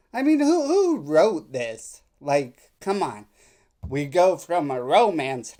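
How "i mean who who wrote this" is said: scoff, who on earth wrote this; exasperated disbelief. Real, not acted. Reacting to stilted texts, loud, high exasperated disbelief. Mocking the texts' odd wording.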